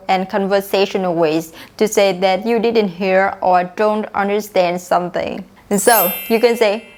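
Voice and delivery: usual speaking voice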